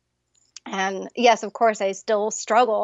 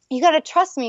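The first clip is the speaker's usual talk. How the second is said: mock teen daughter; 7 semitones higher, 3 dB louder than usual. Imitating teenage daughter.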